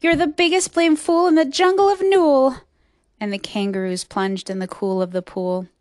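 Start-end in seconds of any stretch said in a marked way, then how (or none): 0.0-2.6 high, loud taunt; loud, high scornful jeer; jeering insult. A narrator's reading. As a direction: hurl it loudly at the fool, pitched up. Kangaroos jeer at Horton.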